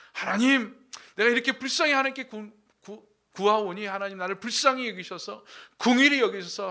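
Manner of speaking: loud, pressing plea for mercy, rushing; hurried loud imploring, a preacher voicing David; loud begging prayer. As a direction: cry out to God, begging.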